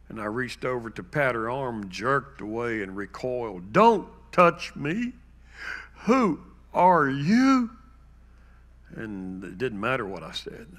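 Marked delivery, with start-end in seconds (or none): 3.7-7.7 much higher, louder, slow panicked mother; frightened cry. Retold or imitated. Mother recoiling from her son's touch, panicked high cry.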